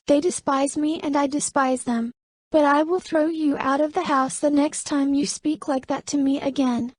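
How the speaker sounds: high-pitched character voice, firm; assertive newcomer. A narrator's reading. Camelia threatening the head cook.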